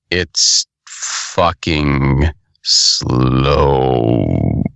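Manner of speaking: low, very drawn-out complaint; exaggeratedly slow. Real, not acted. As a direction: drag each word out to mimic the slowness. Complaining about a slow animation.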